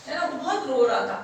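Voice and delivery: ordinary delivery, nothing marked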